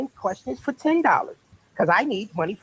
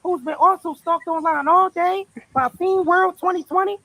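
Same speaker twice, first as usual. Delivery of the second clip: higher, dramatic innocent woman; high put-on voice; 7 semitones higher than usual. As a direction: play the startled suspect. Acting arrest skit.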